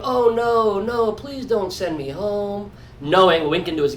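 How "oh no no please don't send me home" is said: theatrical fake pleading; slow, hammed-up begging, put on for show; [name]. [name] playing it out while winking to his guys, theatrical begging. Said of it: "method acting this out theatrically".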